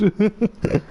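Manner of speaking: bright high-pitched giggle; a quick giggle at himself. Real, not acted. Laughing about wanting to race.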